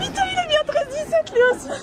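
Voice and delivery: excited exclamation. Real, not acted. Surprised reaction meeting friend.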